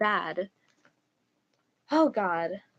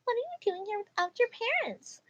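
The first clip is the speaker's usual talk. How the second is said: high-pitched mock mom questioning; put-on high, demanding mom; entitled mom; 12 semitones higher than usual. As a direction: act an incredulous mom, voice pitched way up. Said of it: "Titled Mom".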